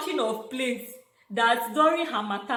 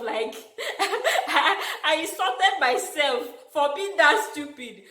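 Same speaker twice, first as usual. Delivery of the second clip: laughing; 6 semitones higher, 4 dB louder, slower than usual. Real, not acted. Laughing at own stupidity.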